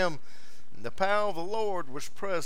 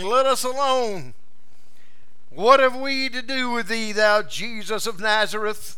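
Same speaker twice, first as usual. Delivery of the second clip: louder, higher cry of the possessed man; demon crying out; 6 semitones higher, 4 dB louder than usual. Acted. The demon shouting at Jesus in the synagogue, loud cry. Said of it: "He cried out with a loud voice".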